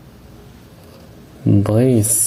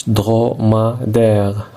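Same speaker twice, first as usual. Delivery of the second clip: slowly syllabified; slower than usual. Shown as a demonstration. Teacher counts syllables for the class, slow and spaced. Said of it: "écris le nombre de syllabes".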